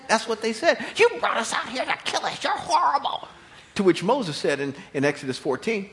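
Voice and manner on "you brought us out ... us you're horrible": whining crowd. Acted.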